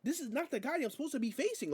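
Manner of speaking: high, protesting mock Spanish accent; Penta impression, bad accent. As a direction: argue with the referee in a badly faked Spanish accent. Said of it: "my accent is terrible".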